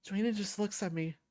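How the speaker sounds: ordinary delivery, nothing marked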